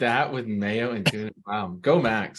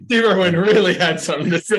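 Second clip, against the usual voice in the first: mock dramatic; 6 semitones higher, 7 dB louder than usual. Joking mock announcement.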